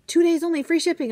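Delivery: high, loud mock promo voice; sales announcement. Oriental Trading tempting her with free shipping, hyped announcement. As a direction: belt it out like an exciting deal. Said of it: "when they tell me they have free shipping".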